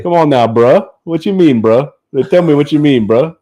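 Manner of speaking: hammed-up Louisiana bruh talk; local Louisiana bruh voice. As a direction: imitate the guys down south. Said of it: "they all say".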